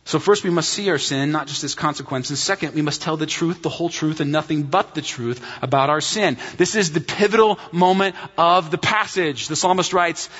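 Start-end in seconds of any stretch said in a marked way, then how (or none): none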